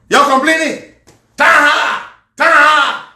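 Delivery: exaggerated imitation of a complaint; mocking imitation. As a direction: throw the catchword back in mockery. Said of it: "This is how they talk".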